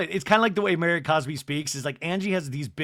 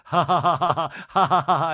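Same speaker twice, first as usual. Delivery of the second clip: mock laugh; 5 dB louder than usual.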